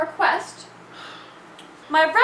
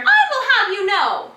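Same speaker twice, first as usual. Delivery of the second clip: raised, high indignant outburst; indignant retort; 6 semitones higher, 5 dB louder than usual. Acted. Offended roommate protesting a dig about stuffing a cup, indignant. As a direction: fire back, affronted.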